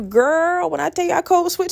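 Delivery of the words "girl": normal talking voice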